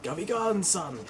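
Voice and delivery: lightly voiced anime baddie; a hint of a smug anime villain. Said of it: "sounds like an anime villain".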